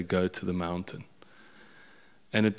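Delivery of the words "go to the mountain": tearful. Retold or imitated. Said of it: "I started crying".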